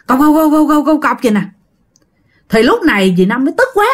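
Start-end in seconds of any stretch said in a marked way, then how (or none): 0.1-1.2 rapid-fire knocking syllables, a little raised; imitated clog footsteps. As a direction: mimic clogs clacking without a break. Imitates nonstop clogs in corridor. (nhại tiếng guốc chạy liên tục)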